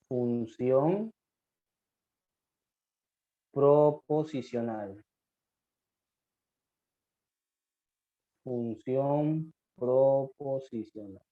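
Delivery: very slow, term drawn out syllable by syllable; término dictado muy lento; slow dictation. Shown as a demonstration. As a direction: spell the term out slowly for the notes. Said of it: "teacher dictating term slowly".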